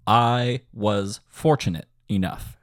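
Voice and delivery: slow, spaced-out words; measured lyric rhythm. Shown as a demonstration. Composer repeats the lyric for students, slow and rhythmic. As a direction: place each word deliberately on its beat.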